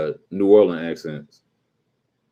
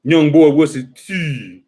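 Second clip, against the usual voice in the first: announced character impersonation, raised voice, last word drawn out; Prop Joe; 5 semitones higher, 4 dB louder, slower than usual. Shown as a demonstration. Comic performing his promised Wire impersonation, Prop Joe voice. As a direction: do a showy impression, stretching the final vowel. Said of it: "Y'all ready for my prop, Joe?".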